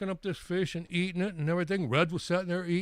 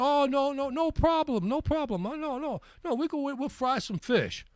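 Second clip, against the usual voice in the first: lightly voiced Jamaican old lady, high and eager; a hint of a fussing grandma insisting it's no trouble; high, lightly mimicked old woman reassuring; 10 semitones higher than usual.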